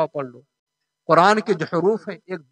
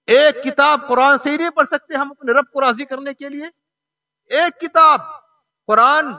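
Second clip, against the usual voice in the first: raised, insistent rhetorical question; challenging preaching; 9 semitones higher, 4 dB louder than usual. Real, not acted. Preacher asking why one book is neglected, challenging sermon. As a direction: demand an answer from the congregation.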